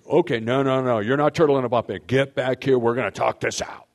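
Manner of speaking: loud, pushy orders; demanding. Shown as a demonstration. Husband chasing a withdrawn wife, loud and demanding. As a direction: haul her back into the fight. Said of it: "or going and saying".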